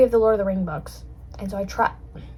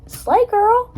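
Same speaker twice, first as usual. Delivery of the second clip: high, loud imitation of an encouraging dad; much higher, louder playful cheer; exaggerated hype; 12 semitones higher, 6 dB louder than usual. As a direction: hype them up in a mock high voice. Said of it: "he didn't say it like that".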